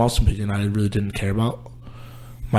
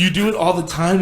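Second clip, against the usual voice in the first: much higher mock complaint; hammed-up exasperated whine at a parent; retold frustration; 9 semitones higher than usual. Son complaining that his mom always cries, mock frustrated. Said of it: "it actually frustrates me".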